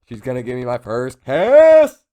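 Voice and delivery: loud, giddy burst about a first kiss; giddy excitement. Retold or imitated. Tokyo imagining his first kiss, giddy and loud. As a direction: blurt it out like a thrilled teen.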